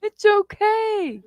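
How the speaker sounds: mock the clueless boyfriend high and drawn out; imitating a chill surfer's it's gone; boyfriend insisting. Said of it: "And he's just like".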